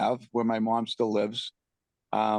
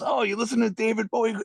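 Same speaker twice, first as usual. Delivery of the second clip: higher, quick, eager question; re-enacted keen neighbour; 10 semitones higher, faster than usual. Neighbour calling over from his deck, imitated as excited. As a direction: imitate a pleasantly surprised stranger.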